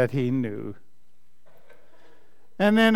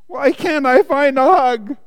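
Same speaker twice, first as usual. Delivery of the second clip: high, loud storybook lament; plaintive wail; 9 semitones higher, 7 dB louder than usual. A narrator's reading. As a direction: wail it like a disappointed little bear. Lonely bear laments in story.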